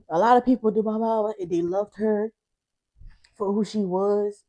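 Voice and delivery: holding back sobs to keep talking; tearful. Real, not acted. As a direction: speak through tears, slowing at the end. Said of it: "I really was not trying to cry".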